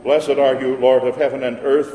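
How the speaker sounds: normal talking voice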